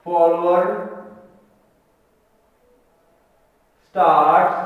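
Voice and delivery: slow, word-by-word dictation. Real, not acted. Teacher dictating the angle of ascent definition, slow dictation. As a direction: leave long gaps for writing.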